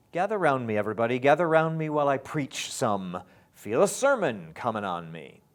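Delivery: loud, high, strongly beat trochees; loud rhythmic reading. Shown as a demonstration. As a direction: belt out the chant so accents show. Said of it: "Can you find the accents?".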